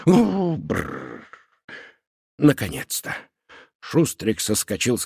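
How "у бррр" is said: shuddering brrr. Acted. Shudders at the memory.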